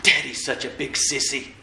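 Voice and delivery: low, soft imitation of a boy; child's grumble. Little boy grumbles as mom walks away, low and soft. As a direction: say it under your breath as an aside. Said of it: "she hears her little boy say".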